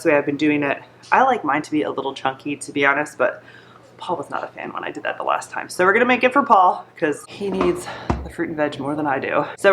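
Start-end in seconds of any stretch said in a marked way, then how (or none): 4.0-5.7 hushed, breathy confiding aside; whispered aside. Real, not acted. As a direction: drop to a secret whisper. Aside about husband's taste.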